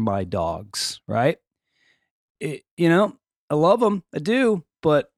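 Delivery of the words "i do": ordinary delivery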